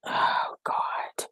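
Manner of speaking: a breathy, despairing "Oh God"; whispered near sob. Real, not acted. Grandmother waiting for her daughter's call, near tears. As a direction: let it out like you're about to cry.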